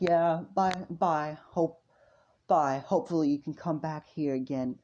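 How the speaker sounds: put-on dismissive merchant; mocking dismissal. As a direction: shoo the customer off loudly. Seller dismisses the customer.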